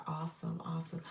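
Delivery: a quick whispered awesome; whispered aside. Real, not acted. Host reacting to a comment, whispering. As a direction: breathe it under the voice.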